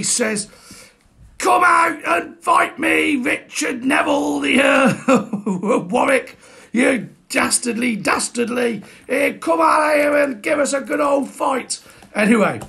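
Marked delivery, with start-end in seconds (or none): 1.4-11.8 high, slow battle-cry challenge; hammed-up defiant yell at the enemy; defiant shout. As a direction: bellow it up at the city walls. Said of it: "Shouts out his defiance at him".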